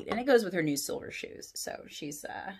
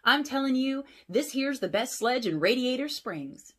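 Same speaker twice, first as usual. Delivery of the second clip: higher, louder proud tow truck; Mater boasting; 7 semitones higher, 4 dB louder than usual. Acted. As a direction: brag about the sledge. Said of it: "Mater cried".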